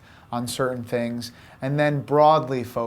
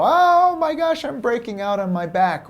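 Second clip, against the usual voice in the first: a squeal of mock horror, much higher; mock panic; 8 semitones higher, 3 dB louder than usual. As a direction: play a drama queen spotting a breakout. Insecure panic over back acne.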